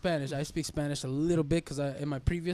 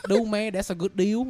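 higher, louder Vietnamese accent; 5 semitones higher, 7 dB louder than usual. Shown as a demonstration. Showing friends how the phrase turns rude, Vietnamese accent. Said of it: "with a Vietnamese accent".

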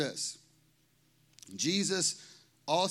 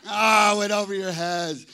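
louder, laughing through the words; 2 semitones higher, 7 dB louder than usual. Real, not acted. Pastor to a congregation that missed the joke, laughing. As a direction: chuckle at the blank faces.

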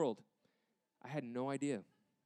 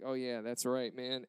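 deflated recognition; 6 dB quieter than usual. Retold or imitated. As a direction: concede it feebly, letting the voice drop. Gives up playing it off.

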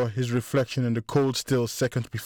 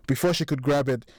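plain reading voice

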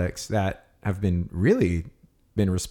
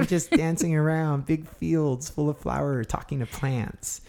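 dreamy high voice; 7 semitones higher than usual. Pretend. Describing green witch dreamily.

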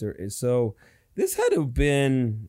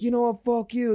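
higher pitched mock fight voice; imitated angry girlfriend; 9 semitones higher than usual. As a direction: voice her yelling back at him. Acted quarrel, girlfriend voiced.

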